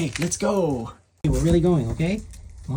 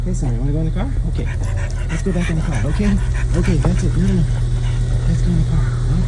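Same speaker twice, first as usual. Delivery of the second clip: higher, louder, sing-song coaxing; coaxing pet talk; 4 semitones higher, 5 dB louder than usual. Real, not acted. Owner coaxing the dog into the car, bright pet talk. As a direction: coax the dog to come along.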